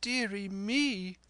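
high, drawn-out wail of dismay; distressed mother. A narrator's reading. Mother finds the captain on her floor, wailing in dismay. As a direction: lament in a put-on woman's voice. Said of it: "cried my mother".

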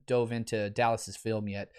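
usual speaking voice

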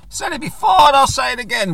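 hoarse, croaky and loud. Real, not acted. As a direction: push it out through a croaky throat. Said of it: "with a croaky voice".

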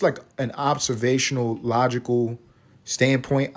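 ordinary talk, nothing marked

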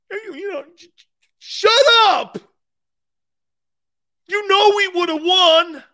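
high, loud whiny fan yelling shut up; sore Niner fan. Retold or imitated. Mocking a 49ers fan to his Eagles guest, high and whiny. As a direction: sulk and shout like a fan who lost.

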